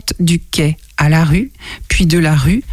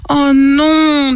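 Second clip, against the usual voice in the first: dismayed cry; 8 semitones higher than usual. Acted. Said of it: "disent les canetons".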